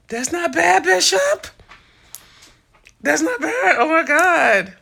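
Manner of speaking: high, loud, thrilled praise; excited. Real, not acted. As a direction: burst out, won over by the idea. Reacts to the suggested name.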